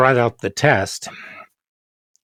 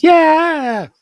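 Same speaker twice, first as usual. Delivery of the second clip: mock smug student; 3 dB louder than usual. Students' smug reply, voiced.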